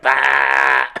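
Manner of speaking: loud, short mock cry; retold wordless yelp; imitated startled yell. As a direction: blurt out a mock holler.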